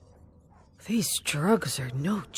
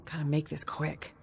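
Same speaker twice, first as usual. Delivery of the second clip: quiet thought; 7 dB quieter than usual. Acted. Gabriel's hurried inner thought.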